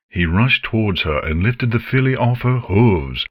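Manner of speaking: ordinary delivery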